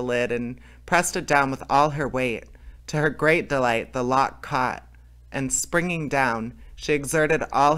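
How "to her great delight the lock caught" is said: ordinary delivery, nothing marked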